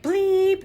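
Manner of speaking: long high-pitched bleep; imitated censor bleep. Mom imitating kids tuning out her nagging, high bleep. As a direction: draw out a high electronic-style bleep. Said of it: "they bleep me out".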